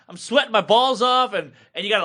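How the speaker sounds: ordinary delivery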